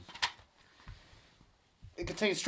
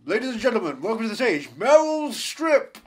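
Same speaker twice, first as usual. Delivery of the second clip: loud MC stretching the name; mock stage announcer, voice rising on the name; strip club announcer; 3 semitones higher, 7 dB louder than usual.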